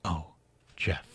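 soft, low, hushed repeat; very softly. A narrator's reading. Doris repeats his name at the door, very soft. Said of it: "she said very softly".

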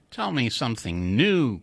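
ordinary delivery

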